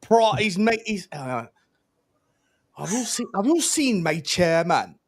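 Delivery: a hint of a Welsh accent, drawn out; singsong Welsh accent, stretched words. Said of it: "my chairman in a Welsh accent".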